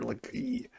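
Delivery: high, soft squeak of fright; a tiny startled shriek; mock scared squeal; frightened squeal. Mimics disciples' fright.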